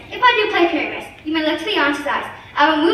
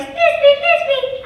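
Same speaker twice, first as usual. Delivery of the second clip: high, loud put-on monster voice; 11 semitones higher, 4 dB louder than usual. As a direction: squeal the name like a monster. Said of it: "I'll speak in a monster voice".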